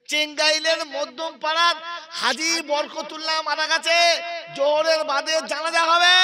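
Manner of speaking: high, loud mock death announcement; mosque loudspeaker announcement. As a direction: proclaim it like a call over the mosque loudspeaker. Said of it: "মাইকে ঘোষণা হচ্ছে".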